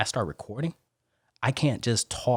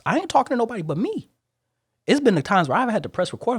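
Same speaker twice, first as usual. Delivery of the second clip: much higher and louder, fired up; worked-up insistence; 13 semitones higher, 5 dB louder than usual. Real, not acted. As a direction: press the point with rising heat. Insists he talks only to himself.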